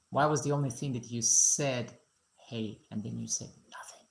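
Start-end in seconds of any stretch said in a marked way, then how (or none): 2.4-4.1 soft, breathy whisper of mock reproach; teasing whisper. Real, not acted. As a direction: whisper it like a playful complaint. Teasing the viewer who only said hey.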